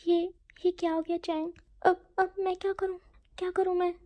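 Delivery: spiral into panic, blaming yourself; panicked self-blame. Acted. Wing panics, asks Chang. (घबराकर, ऊँची और तेज़ अटकती आवाज़ में)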